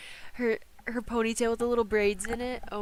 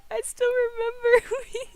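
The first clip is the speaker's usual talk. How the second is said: laughing hard; 14 semitones higher than usual. Real, not acted. Recalling cursed images to chat, high laughing voice. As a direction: barely get it out for laughing. Cracking up over a shared memory.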